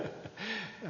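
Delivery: short, light chuckle; small laugh mid-introduction; amused chuckle. Real, not acted. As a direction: chuckle briefly to yourself.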